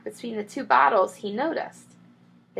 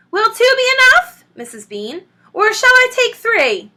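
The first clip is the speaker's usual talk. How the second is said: calling up; 14 semitones higher, 10 dB louder than usual. A narrator's reading. Mabel calling up stairs.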